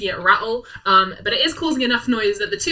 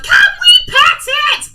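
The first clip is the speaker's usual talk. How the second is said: put-on much higher, louder childish shout; eager kid; 11 dB louder than usual. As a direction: beg like an excited little kid. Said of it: "some of them are like".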